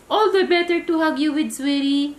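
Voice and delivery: high, loud, fast sugary wolf voice; wolf sweet-talking the girl. Acted. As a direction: coo it quickly like fake Granny. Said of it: "said the wolf sweetly".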